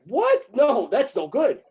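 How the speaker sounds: high, loud, indignant no; hammed-up shocked protest; mock outraged refusal. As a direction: squeal it like scandalized officials. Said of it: "It's like".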